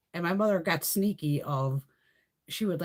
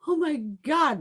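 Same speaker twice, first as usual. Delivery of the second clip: pained; 9 semitones higher, 4 dB louder, much slower than usual. Retold or imitated.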